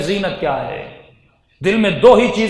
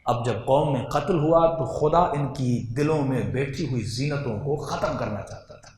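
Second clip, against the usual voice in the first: normal talking voice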